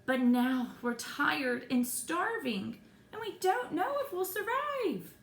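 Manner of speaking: dramatic desperation. A narrator's reading. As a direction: play up the stranded despair, rising high. Acting out stranded, starving survivors.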